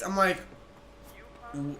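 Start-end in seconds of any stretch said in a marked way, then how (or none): 1.5-1.8 hushed, stunned stammer; quietly baffled; bewildered stammer. Retold or imitated. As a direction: retell it small and soft, stumbling on the first word. Acts out bewildered reply to accusation.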